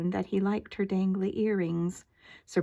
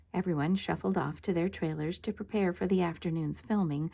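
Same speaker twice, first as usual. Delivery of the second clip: ordinary delivery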